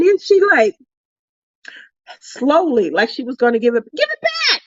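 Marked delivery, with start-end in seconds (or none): 3.9-4.6 high-pitched, urgent demand; mock demand. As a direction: playfully insist on having it returned. Demands her snack back.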